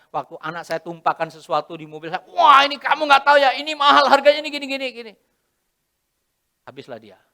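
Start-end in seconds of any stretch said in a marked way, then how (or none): 2.3-5.1 loud, high scolding rant; mean father. Retold or imitated. Harsh father scolding son over the car, loud and high. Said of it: "kalau saya bapak yang jahat".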